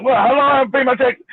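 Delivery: ordinary talk, nothing marked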